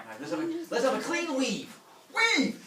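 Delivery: high, building to a loud shout; referee call. Retold or imitated. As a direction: start the match like a ref. Referee starts the contest.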